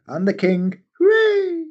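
silly cartoon king. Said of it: "he's just like".

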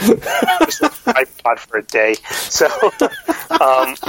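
slow, laughing through the words. Real, not acted. Dad joking about his daughter's punishment, laughing. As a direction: chuckle out the punishment.

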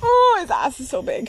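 high, panicky disgust; phobic panic. Real, not acted. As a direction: squeal at the spider. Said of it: "having a panic attack".

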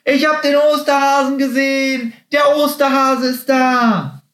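very high, squealing proclamation; excited calling out. A narrator's reading. Happy searches the bush, calling out excitedly. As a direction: shout the news out, high and stretched. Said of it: "rief immer wieder suchend vor sich hin".

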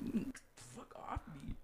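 slow, drowsy whisper; groggy whisper. Retold or imitated. Patient whispering at the nurses to fuck off. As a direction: mumble it half-conscious under your breath. Fighting nurses holding him down.

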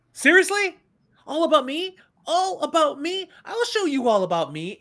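over-the-top outrage. Retold or imitated. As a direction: throw her words back at her. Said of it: "that just puts him over the top".

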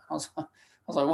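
usual speaking voice

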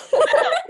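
squealing high laugh; loud high-pitched cackle; high burst of laughter. Real, not acted. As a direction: let a shrieking giggle out. Laughing at shout-out.

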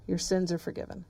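soft, hushed forgiveness; a quiet, tender pardon; said quietly. A narrator's reading. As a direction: speak it gently to the man, barely above a breath. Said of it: "he says quietly to the man".

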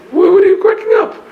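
loud, very high-pitched disbelief; astonished question. Acted. Sages asking Rabbi Akiva why he laughs, mock astonished. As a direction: squeak it out in mock shock.

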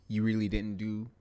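ordinary talk

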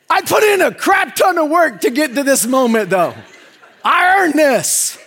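loud, laughing mock brag; big hammed-up victory shout; triumphant shout. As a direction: crow about your hard-won prize. Triumphant boasting about winning her.

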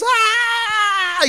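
long, high wailing "aah"; imitated cry. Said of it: "pasó dando gritos". (grito imitado, agudo y largo)

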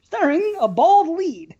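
slow, high trailer billing; playful high announcer voice; mock movie announcer. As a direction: draw out the star billing, hammed up.

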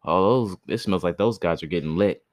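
ordinary delivery

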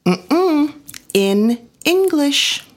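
put-on high, slow scolding child voice; sing-song child correction; teasing kids. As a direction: drag it out like a bossy kid.

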